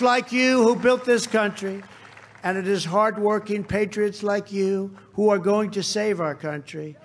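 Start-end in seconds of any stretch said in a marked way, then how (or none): none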